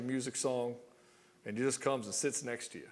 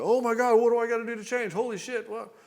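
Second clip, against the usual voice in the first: a much higher, much louder panicked outburst; panicking; 10 semitones higher, 7 dB louder than usual. Retold or imitated. A boyfriend panicking after his partner confesses doubts, much higher and louder. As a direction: lose it completely, frantic and pleading. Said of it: "lose your fucking shit and go".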